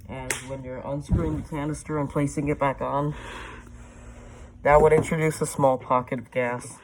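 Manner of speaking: muffled voice inside a mask; through a gas mask. Real, not acted. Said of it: "show you guys what it's like with me in it".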